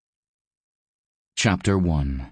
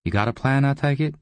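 high-pitched, casual character voice; higher, curious schemer; prompting visitor; 9 semitones higher, faster than usual. A narrator's reading. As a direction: ask it higher, rising at the end. Narrator voices the visiting man.

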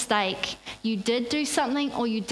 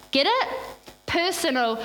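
normal talking voice

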